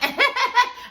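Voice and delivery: a bright high burst of laughter; high giggle. Real, not acted. After the TikTok chant.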